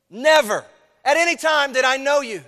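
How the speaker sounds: NEVER hit high and loud, whole line declared; emphatic declaration. Acted. Jesus answers the pleaders, loud and emphatic. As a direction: proclaim it with full weight. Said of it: "There's an emphatic nature".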